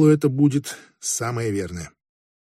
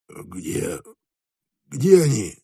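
hoarse croak; 4 semitones lower, much slower than usual. A narrator's reading. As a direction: croak it out haltingly, as narrated. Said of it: "прохрипел он". (хрипло, с запинкой)

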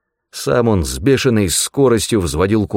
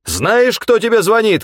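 high furious shout; yelling; 4 dB louder than usual. A narrator's reading. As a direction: bellow down the phone line. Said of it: "заорал в трубку Тагоми".

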